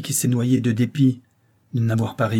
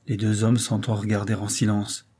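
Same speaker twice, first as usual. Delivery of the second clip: usual speaking voice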